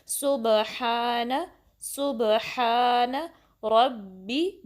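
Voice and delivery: सुबहान रब्बी drawn out very slowly, a pause held on the बा; slow, precise recitation drill; careful tajweed drill. Shown as a demonstration. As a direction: linger on the बा of रब्बी and keep the र heavy. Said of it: "बा पे time देंगे और रॉ को heavy पढ़ना है".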